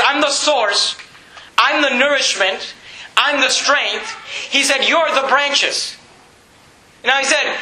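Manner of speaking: fervent preaching. Real, not acted. Preacher explaining Jesus as source of strength, fervent. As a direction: press each phrase on the congregation.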